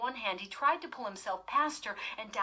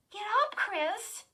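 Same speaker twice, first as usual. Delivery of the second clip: urging; 13 semitones higher, 5 dB louder than usual. A narrator's reading. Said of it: "she urged".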